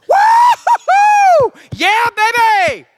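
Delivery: wild high shouted woo-hoo, stretched slow; whooping cheer. Retold or imitated. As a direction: holler at the stage like a rowdy fan. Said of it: "And I do this".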